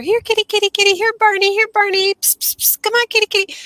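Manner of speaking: high singsong kitty call with psst sounds; cat calling. Retold or imitated. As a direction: coax a lost cat in a high voice.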